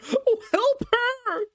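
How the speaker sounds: high, slow, breaking plea; drawn-out plea. Acted. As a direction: stretch out the desperate help. Harker begging wildly.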